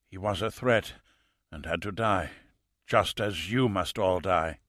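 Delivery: a calm fanatic with little breath left; dying killer. A narrator's reading.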